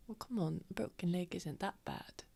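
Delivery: soft, whispery teasing; quiet. Acted. Tavamil plays down Lucian's broken leg, quiet whispery chiding. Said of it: "she just quietly be like".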